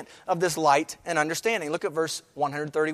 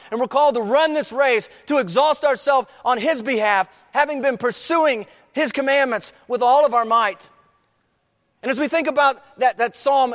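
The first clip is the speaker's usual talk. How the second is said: raised, urgent preaching; fervent preaching; 4 semitones higher than usual. Acted. Preacher calling the church to run the race, urgent. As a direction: drive the congregation forward.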